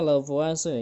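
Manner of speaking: "Lavoisier" said as la-vwa-se; French-style "Lavoisier", la-vwa-se. Shown as a demonstration. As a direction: say "Lavoisier" in three syllables, la-VWA-se. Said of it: "Biasanya dibacanya".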